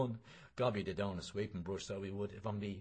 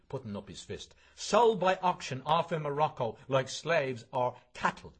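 impassioned protest; 5 semitones higher, 5 dB louder than usual. Acted.

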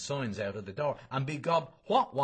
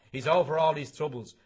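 passing it off; 4 semitones higher, 6 dB louder than usual. Acted. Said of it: "trying to pass it off".

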